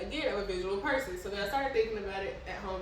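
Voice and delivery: ordinary talk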